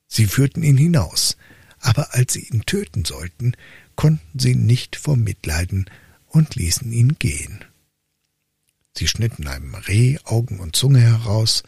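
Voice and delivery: plain reading voice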